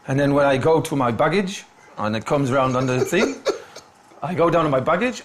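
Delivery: ordinary talk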